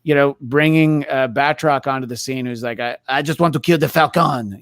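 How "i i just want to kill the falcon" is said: jokey put-on French accent. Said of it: "that's my great French accent".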